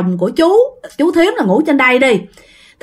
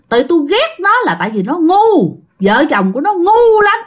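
scornful ghost; 7 semitones higher than usual. Retold or imitated. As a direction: spit out her contempt for the foolish couple. Ghost scolds the couple.

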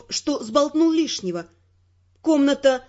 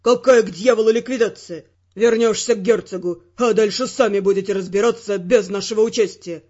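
low, loud male outburst; enraged Ferrant; 5 semitones lower, 3 dB louder than usual. Acted. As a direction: blow up at her.

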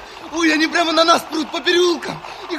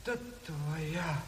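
very soft, broken grief; grieving; 11 semitones lower, 20 dB quieter, slower than usual. Acted. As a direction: murmur over the dead friend. Горе над телом командира. (тихое горе)

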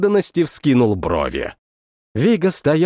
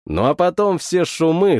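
usual speaking voice

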